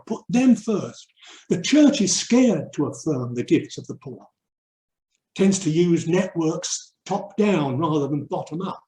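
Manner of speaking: usual speaking voice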